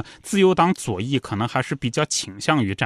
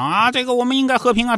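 lightly mimicked public speaker; 11 semitones higher than usual. Peace campaigner addressing the square, lightly mock oratory. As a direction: imitate a square orator, pitched well up. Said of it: "他要是在广场上讲".

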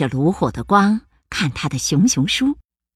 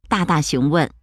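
ordinary delivery, nothing marked

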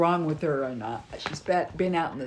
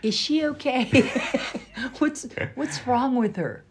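puzzled onlookers; 3 semitones higher than usual. Retold or imitated. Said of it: "people are like".

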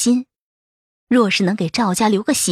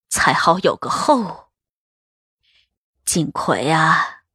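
slow, lower, weary consoling voice; sigh, then soothe gently like an elder; sighing old matriarch; 3 semitones lower, slower than usual. A narrator's reading. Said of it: "叹道".

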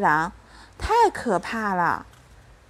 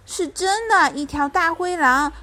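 put-on higher, urgent child's plea; raised pitch, pressing to be believed; alarmed insistence; 7 semitones higher than usual. A narrator's reading. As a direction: insist it's true, a little frightened. Child insists wolf is real.

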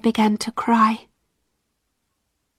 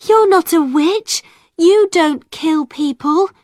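high, louder pleading; cried protest; 7 semitones higher, 4 dB louder than usual. A narrator's reading. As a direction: insist he is not a witch.

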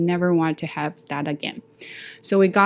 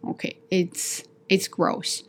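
disgusted whisper; 6 dB quieter, slower than usual. Real, not acted.

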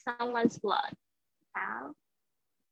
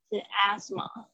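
ordinary talk

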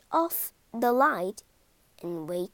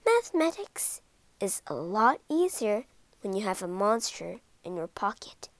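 plain reading voice

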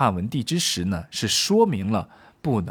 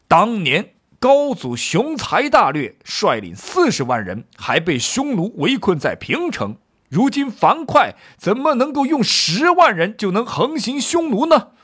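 high-pitched, forceful acted rebuke; 季布怒斥; 8 semitones higher, 4 dB louder than usual. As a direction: argue indignantly, building to a loud challenge. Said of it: "季布接着说道". (激愤驳斥，声调高昂)

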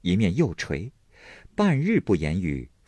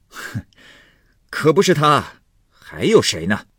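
huff, then a loud, high retort; angry scorn; 7 semitones higher, 5 dB louder than usual. Acted. Husband confirming her brother is to blame, loudly scornful. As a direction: confirm it with indignant force.

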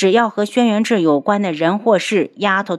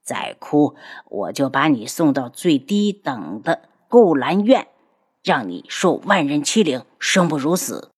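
lower, oily sneering voice; sinister sneer; 4 semitones lower than usual. Acted. Ghost doctor sneers a cruel threat at the girl, lower and oily. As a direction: taunt her with mock sweetness. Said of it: "阴阳怪气的道".

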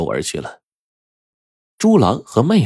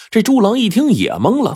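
usual speaking voice

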